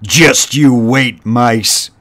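loud, raging snarl; raging rat. A narrator's reading. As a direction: spit it at the mice in a rage. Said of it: "he raged".

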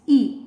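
final "E" said ih, as starts ink; short I sound. Shown as a demonstration. Teacher explains short I to learners, "E" said ih. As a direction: end on "E" sounded as ih. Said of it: "The short sound of I".

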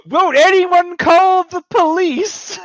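put-on high, loud, slow old lady shriek; hammed-up falsetto cry for help; mock bar matron shriek. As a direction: squawk it like a panicked landlady. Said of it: "she goes".

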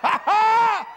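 high, loud "ha ha"; sudden staged laugh. Shown as a demonstration. Preacher acting laughter while walking down the street, loud burst. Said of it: "just start laughing".

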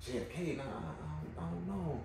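very soft, hesitant muttering; murmured, halting denial; unsure muttered recall. Retold or imitated. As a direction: imitate someone quietly drawing a blank. Acting out his failed recollection.

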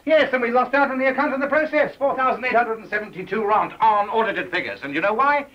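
loud, high-pitched scolding; exasperated boss telling off staff; angry rebuke. Acted. Boss angrily rebuts employee's boast.

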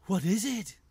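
excited badger wanting to know; eager badger. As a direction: ask like a curious little animal. Badgers curious about the card.

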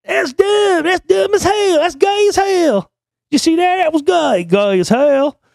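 squeaky bratty kid mocking everything; Cartman. Bratty cartoon kid jeers during the race, high and loud. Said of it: "everything that comes out of Cartman's mouth is".

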